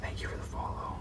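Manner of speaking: soft whispered thanks; whispered thank-you to a viewer. Real, not acted. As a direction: breathe the thanks out.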